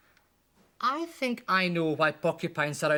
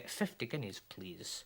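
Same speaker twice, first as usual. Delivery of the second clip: smug hush; 6 semitones lower, 11 dB quieter than usual. Acted. Dentist naming his fee.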